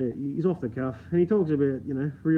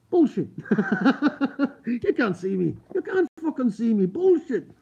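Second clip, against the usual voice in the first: high-pitched, cracking-up protest; laughing disbelief; 7 semitones higher than usual. Real, not acted. Streamer scoffing that viewers can't see them, laughing hard. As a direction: cry bullshit through big laughs, pitched right up.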